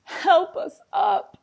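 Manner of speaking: high, slow plea for help; mock-pleading cry. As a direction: call out like a tumbling letter. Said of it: "cried A B C".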